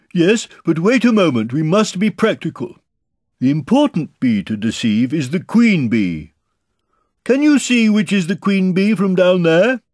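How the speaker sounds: high, loud bear voice; Pooh directing earnestly. A narrator's reading. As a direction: call practical orders down.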